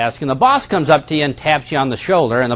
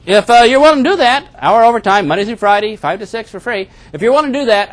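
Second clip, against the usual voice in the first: eager boss; 6 semitones higher than usual. Retold or imitated. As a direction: pitch the deal like a keen boss. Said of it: "And the boss says".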